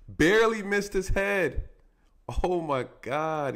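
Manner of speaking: high, loud, drawn-out disbelief; shocked exclamation. Real, not acted. Commentator watching a head nearly hit on replay, loudly astonished. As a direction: exclaim it, stretching the words in amazement. Reacts to the near miss on replay.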